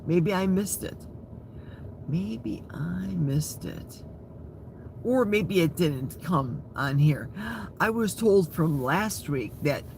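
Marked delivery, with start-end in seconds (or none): 2.1-4.0 slow, breathless whisper to herself. Shown as a demonstration. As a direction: whisper it slowly, just air. Repeats line as a whisper.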